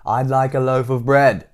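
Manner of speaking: loud, slowed-down insistence; shouted. A narrator's reading. Mister Noisy to Missus Crumb who won't hear, shouted. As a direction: raise your voice and space it out. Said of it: "Mister Noisy shouted".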